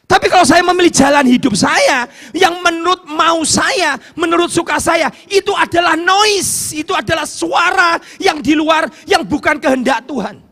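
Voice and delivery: loud, high, fervent preaching; preaching at full force. Acted. Preacher warning the congregation against self-chosen paths, fervent shout. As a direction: drive the warning home to the congregation.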